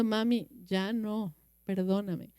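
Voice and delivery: put-on child pleading quietly with her mother; little girl pleading. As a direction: beg mommy for forgiveness in a small voice.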